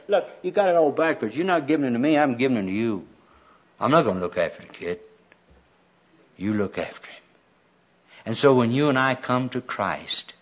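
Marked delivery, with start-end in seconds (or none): 0.1-7.2 dropping steadily lower and softer; skit voice from above. Acted. Skit figure handing the baby back, low matter-of-fact refusal. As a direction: set them straight, sinking to a low, quiet finish. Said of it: "Doss used to do this skit".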